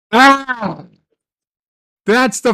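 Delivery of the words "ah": frustrated scream. Real, not acted. Raging scream at dead Star Trek.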